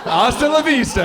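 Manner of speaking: high, shouted action-hero farewell; loud, gleeful send-off; triumphant catchphrase. Acted. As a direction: call it out with relish. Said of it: "I say".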